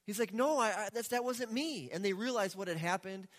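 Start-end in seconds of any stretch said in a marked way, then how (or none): 0.3-1.9 freaking out. Retold or imitated. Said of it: "Isaac starts freaking out".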